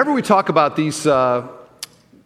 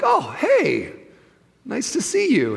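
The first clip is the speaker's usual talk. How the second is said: pitched way up, bright forced greeting; mock surprised greeting; 10 semitones higher than usual. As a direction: greet her with high, startled cheer. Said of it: "I'm like".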